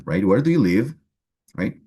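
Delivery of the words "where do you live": "Where do you live?" said with the pitch lifting clearly toward "live"; rising question tune. Shown as a demonstration. Teacher showing students the rising intonation of a question. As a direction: let the question climb upward at the end. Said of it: "we do a rising intonation".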